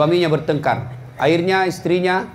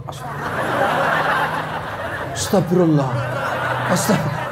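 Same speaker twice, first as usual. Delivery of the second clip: 1 mock istighfar; slower than usual. Mocking man staring while praying.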